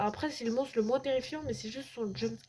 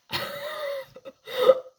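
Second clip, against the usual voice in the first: nervous laugh; 8 dB louder than usual. Real, not acted. Laughing after jumpscare.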